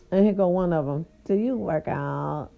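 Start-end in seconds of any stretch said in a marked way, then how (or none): 1.2-2.5 slow, lower, breathy-free mock Kim K drawl. The other woman asking about her workout, lightly voiced like Kim K. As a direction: imitate a bored reality star asking. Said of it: "In her Kim K voice".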